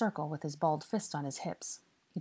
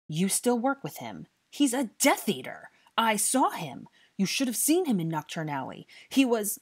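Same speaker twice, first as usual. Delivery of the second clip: loud, high-pitched outraged accusation; angry accusation; 5 semitones higher, 7 dB louder than usual. A narrator's reading. Boy accuses a guest of being a Death Eater, outraged. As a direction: accuse him in rising outrage.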